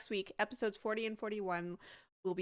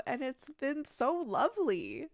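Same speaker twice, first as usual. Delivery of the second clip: ordinary delivery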